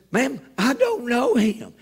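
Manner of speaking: high, drawn-out comic protest; exasperated denial. Acted. Passenger disowns rude man to flight attendant, exasperated.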